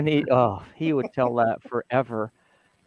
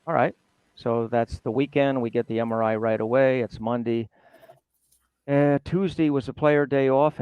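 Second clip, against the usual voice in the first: ordinary talk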